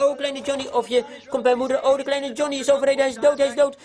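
high-pitched acted cry of alarm; mock distress. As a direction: cry out as if reporting an accident, hammed up. Acteert paniek om overreden kind. (gespeelde ontzetting, hoog uitgeroepen)

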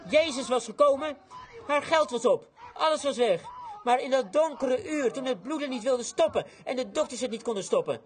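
high, loud, punched phrases; climactic preaching. Acted. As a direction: preach with full-throated urgency. Preaches at full force.

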